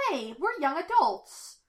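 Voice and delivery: high, loud, drawn-out protest; indignant objection. A narrator's reading. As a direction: call out after them, offended. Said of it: "Mabel objects".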